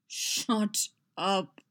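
exasperated, drawn-out groan. A narrator's reading. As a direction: groan it as a weary order. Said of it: "Boba groaned".

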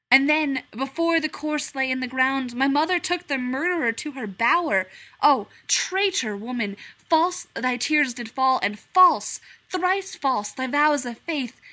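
loud theatrical verse; declaiming Hamlet. Acted. Hamlet declaiming to the stranger, loud and theatrical. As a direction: proclaim it from the stage.

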